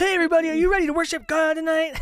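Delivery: high, loud hammed-up Australian worship leader; fake Australian accent. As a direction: overeager Aussie pastor hyping the crowd. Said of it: "with a fake Australian accent".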